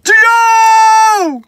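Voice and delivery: high, loud call from far off; answering shout from a distance; distant scream. Retold or imitated. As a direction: yell back to your friend through the trees. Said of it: "I hear my buddy scream from a distance".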